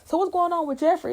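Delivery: high, nosy question; Will asking. Retold or imitated. Will questioning Carlton about Jeffrey, high and nosy. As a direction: pitch up high, prying. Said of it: "He's like".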